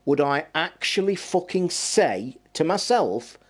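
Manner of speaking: normal talking voice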